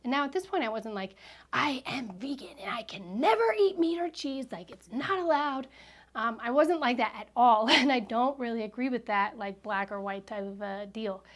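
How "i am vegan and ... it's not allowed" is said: higher-pitched, exaggerated absolutist proclamation; hammed-up vegan declaring a strict rule; mock preachy vegan. Woman parodying the strict vegan she wasn't, mock preachy. Mimics rigid vegan stance.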